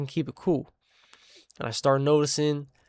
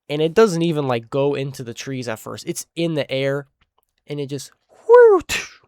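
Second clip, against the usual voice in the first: whoosh sound effect; 6 dB louder than usual. Retold or imitated. Imitating the rock's flight sound.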